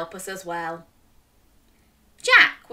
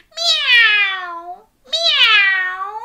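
high, loud rising meow; meowing like a cat; 9 semitones higher, 7 dB louder than usual. Pretend. Showing children how to meow, high cat call. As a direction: mew like a cat. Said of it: "can you meow like a cat".

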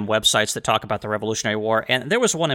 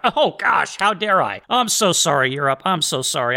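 sarcastic apology; 3 semitones higher than usual. Pretend. Host pretends to apologize to Europe, sarcastic gushing. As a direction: overdo the contrition, clearly insincere. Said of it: "I'm being sarcastic".

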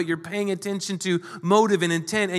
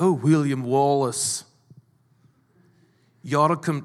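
badly faked Scottish accent, lower voice; attempted Scottish accent; 5 semitones lower than usual. Preacher quoting Braveheart's lure to William Wallace, poor Scottish accent. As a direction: have a half-hearted go at a Scottish accent. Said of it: "I can't do the accent".

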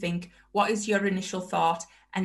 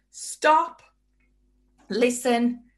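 sharp command; 4 semitones higher, 3 dB louder, much slower than usual. Real, not acted. Commands distracted listener to stop.

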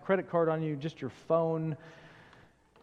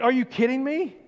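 higher, louder hammed-up outrage; mock disbelief; 6 semitones higher, 4 dB louder than usual. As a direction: play it stunned and a bit whiny. Shocked again about newer phone.

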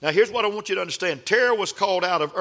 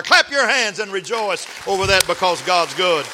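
bright congregational rally cry; 3 semitones higher, 6 dB louder than usual. Real, not acted. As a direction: whip up the crowd, louder. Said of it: "rallying the congregation".

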